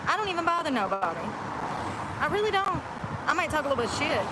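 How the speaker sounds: high, fast mock-innocent protest. Real, not acted. Streamer insisting she bothers nobody, mock innocent. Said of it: "protesting innocence, mock indignant".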